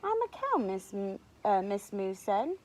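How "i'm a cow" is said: high little cow voice; cow character. A narrator's reading. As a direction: introduce the cow in a high pitch. Miss Moo introduces herself.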